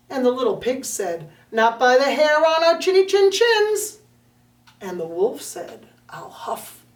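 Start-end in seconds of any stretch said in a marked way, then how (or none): 1.5-4.0 a touch higher, louder little pig; stubborn little pig answering the wolf; defiant little pig. Acted. Little pigs refuse the wolf. 6.1-6.8 whispered wolf threat; sneaky whispered wolf; menacing whisper. Acted.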